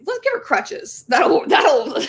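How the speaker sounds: high, loud mock-helpful voice; mocking imitation. Medical staff proposing a useless fix, mocking high voice.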